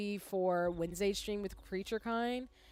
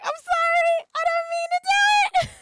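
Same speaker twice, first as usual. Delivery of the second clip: very high, loud mock wail; over-the-top pleading shriek; hammed-up wailing apology; 12 dB louder than usual. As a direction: squeal the apology in comic distress. Wails an apology to friends.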